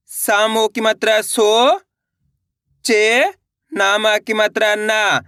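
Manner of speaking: slow, each syllable set apart; patient slow phonics reading; slow spelling-out. Shown as a demonstration. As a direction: sound it out for a child. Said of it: "मैं किस तरीके से एक अक्षर का उच्चारण करके".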